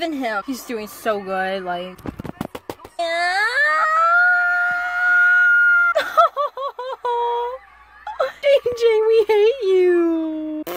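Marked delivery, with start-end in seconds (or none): 2.0-7.6 long high laughing scream. Real, not acted. 8.5-10.6 mock booing. Real, not acted. Mock booing a rival skater.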